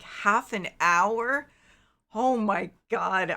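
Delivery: shocked disbelief. Retold or imitated. Streamer reenacts hearing about twenty relatives to hug, stunned. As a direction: drag out the shock like you can't believe it. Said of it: "I was like".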